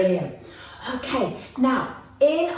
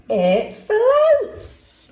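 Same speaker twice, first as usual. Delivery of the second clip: high, loud delighted reveal; excited announcement; 10 semitones higher, 4 dB louder than usual. Real, not acted. Teacher announcing the boat floats, high and excited.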